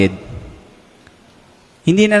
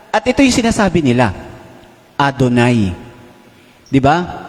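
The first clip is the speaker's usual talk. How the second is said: ordinary delivery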